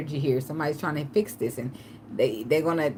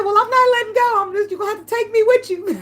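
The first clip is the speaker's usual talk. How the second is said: high, loud, laughing not letting go; high laughing refusal; 7 dB louder than usual. Retold or imitated. To boyfriend dragging her, loud high laughing refusal. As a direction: hold on and laugh it out. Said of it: "I said".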